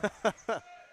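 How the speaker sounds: quick amused chuckle; short laugh. Real, not acted. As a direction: laugh briefly at a partner's reply. Laughs at partner's reply.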